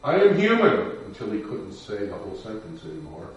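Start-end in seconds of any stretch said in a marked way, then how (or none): 0.0-0.9 high, loud strained cry; retold shout. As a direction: shout it again, weaker but still defiant. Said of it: "And he shouted out".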